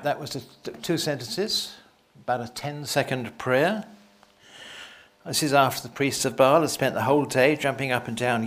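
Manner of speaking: ordinary talk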